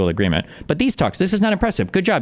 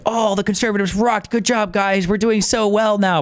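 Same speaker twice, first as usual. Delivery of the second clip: higher smug gloating voice; mocking Trump boast; 7 semitones higher than usual. Mimicking Trump claiming credit for German elections, smug and high. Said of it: "trying to take credit for, like".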